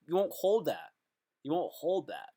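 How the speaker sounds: normal talking voice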